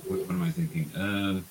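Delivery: normal talking voice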